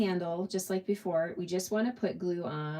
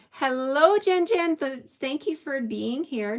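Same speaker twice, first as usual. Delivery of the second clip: high, louder, bright hello to a friend; host warmly greeting latecomer Jen Jen; excited greeting; 8 semitones higher, 5 dB louder than usual. Real, not acted. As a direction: welcome her in with delight. Greeting a late viewer.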